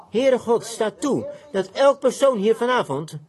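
preacher's praying voice, rising and pressing; fervent prayer. Acted. Prays aloud to God.

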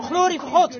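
high, loud cry of praise; joyful shout. Retold or imitated. Healed blind man praising God, shouted. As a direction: shout it out jubilantly. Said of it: "ik hoorde hem schreeuwen".

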